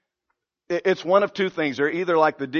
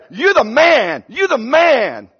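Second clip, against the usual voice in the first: louder, higher praise; cheering teammate; 5 semitones higher, 5 dB louder, slower than usual. Retold or imitated. Teammate congratulating the player who scored. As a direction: hail him like a hero.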